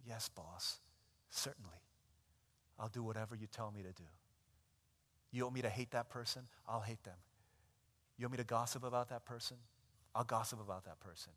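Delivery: low, soft, submissive voice; meek obedience. Acted. Believers obeying sin like a boss, low and soft. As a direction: give in without a fight. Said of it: "a lot of us are saying".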